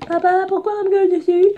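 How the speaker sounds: put-on high, fast little kid voice; small child voice. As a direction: whine like a scared kid to dad. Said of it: "le fils était en mode". (petite voix d'enfant aiguë et rapide)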